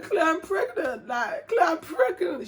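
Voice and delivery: high, drawn-out wailed confession; mock crying. Telling best friend she is pregnant, imitated crying. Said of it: "I was crying".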